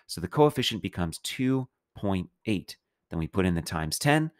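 ordinary talk, nothing marked

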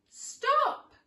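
high, loud shout; old man shouting after the gingerbread man; cry of stop. Acted. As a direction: yell after the runaway. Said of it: "cried the girl".

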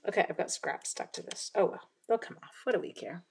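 ordinary talk, nothing marked

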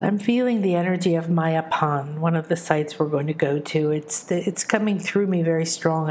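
usual speaking voice

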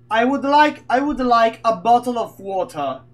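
loud, deliberate mock-British request; hammed-up British English, carefully enunciated; mock British accent. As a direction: order in a put-on British accent. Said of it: "Sono britannici di merda".

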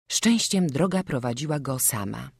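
plain reading voice

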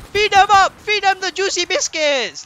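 high, loud drawn-out call; gleeful stretched-out shout; excited cheering. Pretend. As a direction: whoop it out like a rallying cry. Cheering on feeding the bears.

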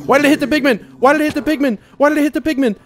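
high, loud panicked question; shrill panic. Real, not acted. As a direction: yelp it out in alarm. Pigman attacked, panic.

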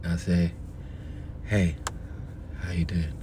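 very low, soft put-on voice; low seductive phone voice; fake deep voice. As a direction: drop the voice low to sound smooth. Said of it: "What's wrong with your voice?".

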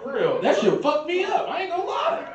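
high, loud laughing confession. Real, not acted. Comedian cracking up telling the crowd it got him, laughing loudly. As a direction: laugh through every word.

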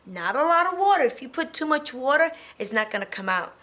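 ordinary delivery

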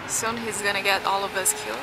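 usual speaking voice

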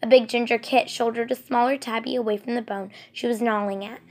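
ordinary delivery

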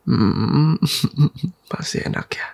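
ordinary talk

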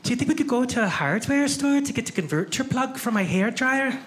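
imitating the Scottish friend; Scottish accent. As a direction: friend just off the plane from Scotland, Scottish accent. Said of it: "the first thing she said was".

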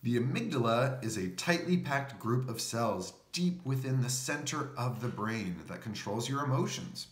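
usual speaking voice

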